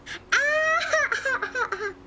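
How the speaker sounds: high wheezy panting laugh; panting dog laugh. Real, not acted. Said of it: "that dog laugh".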